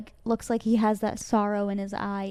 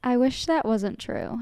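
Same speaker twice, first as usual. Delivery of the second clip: ordinary talk, nothing marked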